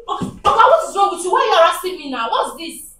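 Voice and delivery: agitated protest. Acted. Barber confronting harassing client, agitated and loud. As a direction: demand an explanation, loud and upset.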